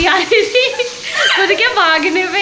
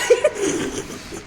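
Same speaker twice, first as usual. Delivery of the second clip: high laugh after a fright; burst of laughter; 4 dB quieter than usual. Real, not acted. Vlogger laughing at her startled friend, laughing.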